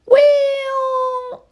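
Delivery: high, drawn-out squawky well; parrot copying me. Retold or imitated. Bird copying the owner's voice, high drawn-out parrot. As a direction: stretch the word like a mimicking parrot. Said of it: "just in my voice".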